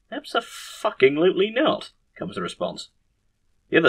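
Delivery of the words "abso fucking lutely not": high, indignant refusal; emphatic refusal. Acted. Surgeons answering the head surgeon's plan, high emphatic refusal. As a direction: refuse it outright, voice pitched up.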